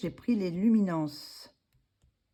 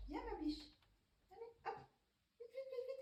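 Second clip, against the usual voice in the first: high, soft voice off-mic; coaxing a pet; 18 dB quieter, faster than usual. Real, not acted. Owner coaxing her louloute indoors, high coaxing voice. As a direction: call a pet sweetly, high and quiet. Calls her dog back inside.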